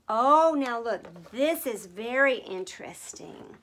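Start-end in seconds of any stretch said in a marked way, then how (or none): none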